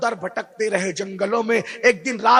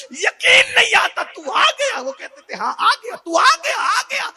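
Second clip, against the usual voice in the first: 1 tearful high preaching; 4 dB louder than usual. Acted.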